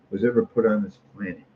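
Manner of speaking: ordinary talk, nothing marked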